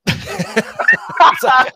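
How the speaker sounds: breathless high laugh through the words; can't stop laughing at the bad Aussie accent. Real, not acted.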